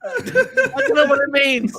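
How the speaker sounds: high, slow words through laughter; laughing. Real, not acted. Teller laughing at his own ultrasound joke. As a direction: barely get it out while cracking up.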